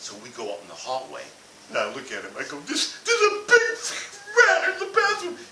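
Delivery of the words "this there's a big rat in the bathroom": high, loud, half-laughing panic; frantic squeak. Retold or imitated. Telling brother-in-law about the rat, high-pitched and frantic. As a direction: blurt it out, voice cracking up high.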